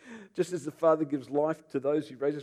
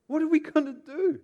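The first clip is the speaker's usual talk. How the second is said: much higher, louder panic; high, loud panicked question; panicked priests; 6 dB louder than usual. Acted. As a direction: cry it out in alarm. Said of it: "This is what they said".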